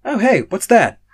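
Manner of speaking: high, loud sudden call-out. Acted. Robin startling Drago from behind, a high loud call. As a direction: pop up with a bright loud question.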